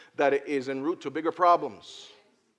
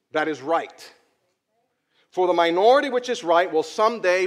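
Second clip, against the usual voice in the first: preaching; 3 semitones higher, 7 dB louder than usual. Acted. Preaches a quote.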